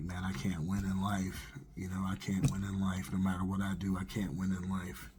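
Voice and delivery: low, soft, slightly dejected refrain; quietly resigned, recalling despair; defeated. Retold or imitated. Man retelling his despair after being cheated on, defeated.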